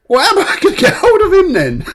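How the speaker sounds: high, loud mock protest with laughter; exasperated debt collector. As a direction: wail it as a baffled debt collector. Debt collector told his debtor is dead.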